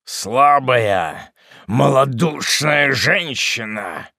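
heavy scornful reproach; contemptuous grumble. A narrator's reading. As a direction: sneer it slowly with disdain. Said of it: "пробормотал отшельник".

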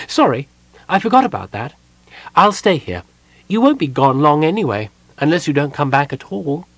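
high, loud Kender chatter; breezy little apology, up high; chirpy Kender. A narrator's reading. Tass cheerfully agrees to stay behind.

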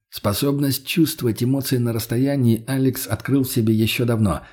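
ordinary delivery, nothing marked

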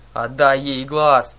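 loud, drawn-out order; exclaimed order. A narrator's reading. Girl ordering her friend to return the eye, exclaiming. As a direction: call it out slowly and loudly. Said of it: "воскликнула Аннабель".

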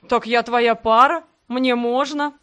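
loud gloating exclamation, no real laugh; с хохотом. A narrator's reading. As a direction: crow it loudly, drawn out. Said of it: "расхохотался он". (громко, торжествующе)

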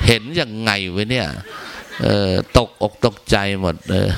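normal talking voice

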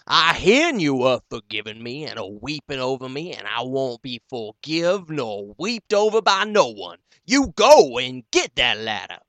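loud furious roar; roaring mad. Acted. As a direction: roar it up out of the cistern. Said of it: "Hank fairly roared".

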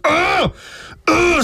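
high, aroused-sounding groan; worked-up groan. Retold or imitated. Man acting out how provoked he gets, high worked-up groans. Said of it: "så bliver jeg bare sådan".

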